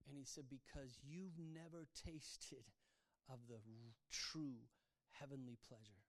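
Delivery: hint of God speaking, slow and hushed; slow, gently solemn retelling of God's words; voice of the Lord. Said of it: "the Lord spoke this to me".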